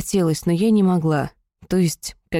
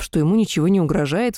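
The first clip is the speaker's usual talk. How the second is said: ordinary delivery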